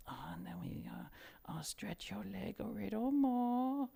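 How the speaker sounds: put-on high whispered Chinese accent, slow; Ting Ting ASMR whisper. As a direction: breathe it like a Chinese ASMR artist. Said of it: "her just saying some shit".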